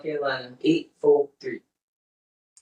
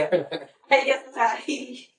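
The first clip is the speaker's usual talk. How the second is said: laughing; 6 semitones higher, much slower than usual. Real, not acted. Laughing through her retelling.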